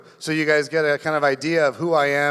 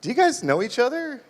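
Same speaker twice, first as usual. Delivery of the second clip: nurse voice; 7 semitones higher than usual. Retold or imitated. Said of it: "and she was like".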